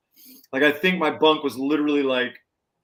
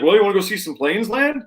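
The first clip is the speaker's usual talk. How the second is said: admiral's offer, a touch higher and louder; imitated admiral; 6 semitones higher, 6 dB louder than usual. Retelling the admiral's casual offer to the band, louder and higher. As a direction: play the admiral inviting the guests, upbeat.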